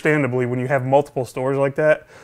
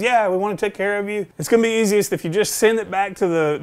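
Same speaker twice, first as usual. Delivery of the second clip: lightly voiced rep, higher pitch; imitated helpful store rep; 7 semitones higher than usual. Store rep offering an easy return, lightly imitated higher voice. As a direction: reassure the customer as a keen rep would. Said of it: "He goes".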